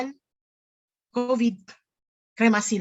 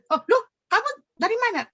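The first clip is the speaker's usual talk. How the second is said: startled question; 10 semitones higher, much faster than usual. Retold or imitated. Family stunned, meeting the unknown son.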